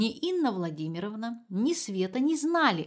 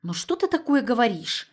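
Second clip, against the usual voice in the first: louder indignant reproach; 4 semitones higher, 6 dB louder than usual. A narrator's reading. Mother indignant at daughter's suggestion. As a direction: bristle with indignation. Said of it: "опять возмутилась Инна Владимировна".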